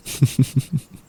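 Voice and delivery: airy rising giggle; a playful little laugh; teasing chuckle; amused giggle. Acted. Teasing partner.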